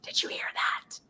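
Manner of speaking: put-on child's hushed whisper. Little daughter whispering to her mom in Milan. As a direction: breathe it like a secret to mom. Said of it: "she starts whispering".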